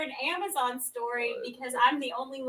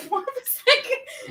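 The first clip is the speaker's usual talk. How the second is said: laughing; 11 semitones higher, much slower than usual. Real, not acted. Laughing while asking.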